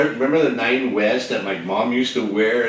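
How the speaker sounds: ordinary talk, nothing marked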